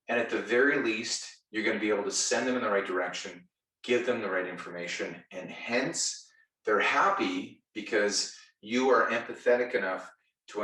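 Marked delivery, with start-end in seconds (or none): none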